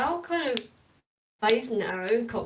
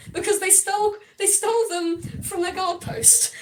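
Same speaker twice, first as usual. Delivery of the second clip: laughing; 8 semitones higher than usual. Real, not acted. Laughing while explaining.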